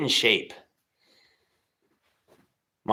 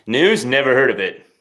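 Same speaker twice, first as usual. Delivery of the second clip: loud, higher playful quip; Billy's comment; 3 semitones higher, 8 dB louder than usual. Retold or imitated. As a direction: voice the viewer's cheeky reply. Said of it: "Exactly, Billy".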